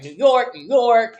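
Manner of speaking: loud, high mock boasting; mocking New Yorker. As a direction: parrot her boast, punched up high. Mocks instructor's boasting about New York.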